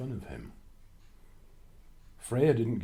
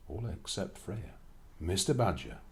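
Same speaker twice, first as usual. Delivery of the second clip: usual speaking voice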